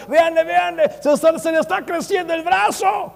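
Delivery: high, excited showman's shout; mock faith healer. Preacher mocking a TV healer, high excited hammed-up shout. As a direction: hype up a fake miracle.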